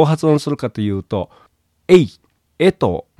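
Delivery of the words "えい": えい as e gliding quickly into i; tight diphthong. Shown as a demonstration. Teacher modelling the English vowel, えい said tightly as one glide. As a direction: squeeze e and i together in えい. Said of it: "ほとんど同時に発音するぐらい詰めて".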